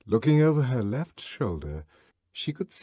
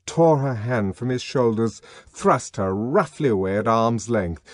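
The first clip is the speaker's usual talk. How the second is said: ordinary delivery, nothing marked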